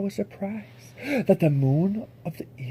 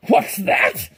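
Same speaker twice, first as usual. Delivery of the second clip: snapped; 2 semitones lower, 5 dB louder than usual. Acted. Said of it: "snapped the king".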